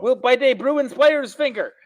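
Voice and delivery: high, put-on quoting voice. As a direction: quote it in a funny voice. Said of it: "That's what he said".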